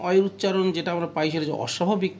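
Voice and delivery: usual speaking voice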